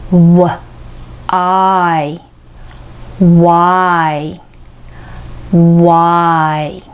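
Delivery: わ with rounded lips, then I with the jaw dropped, then Y whole; Yを分けて練習; Y broken into parts. Shown as a demonstration. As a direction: build Y from わ and I. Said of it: "わという時にですね".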